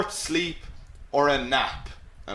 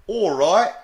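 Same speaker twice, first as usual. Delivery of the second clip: rising English-accented greeting, louder and higher; 5 semitones higher, 6 dB louder than usual. Shown as a demonstration. Teacher showing how the English say it, English accent. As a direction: do a lively English "all right?". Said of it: "they'd, of course, say it like".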